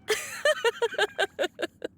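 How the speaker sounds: high, haughty villain laugh; scornful laugh. Acted. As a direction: laugh off the threat with contempt. Villain scoffs at challenge.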